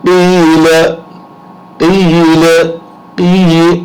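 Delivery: قیل with the ی cut short, not drawn out; short stopped vowel. Shown as a demonstration. Teacher showing students the unlengthened vowel, clipped and high. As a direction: stop the voice after قی in قیل. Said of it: "اب آواز کو روک دیا".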